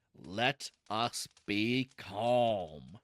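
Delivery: very slow, low pronouncement; solemn calm. A narrator's reading. Uncle urging family to be calm, solemn. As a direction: intone each word low and drawn out.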